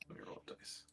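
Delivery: muttered, unvoiced aside while reaching for the dice; breathy under-the-breath aside; whispered aside. Real, not acted. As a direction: whisper it to yourself.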